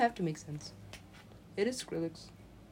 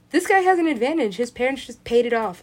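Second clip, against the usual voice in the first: raised, higher-pitched complaint; indignant protest; 4 semitones higher, 8 dB louder than usual. Retold or imitated. Broke worker objecting to someone's advantage, indignant and loud. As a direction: protest loudly at the unfairness.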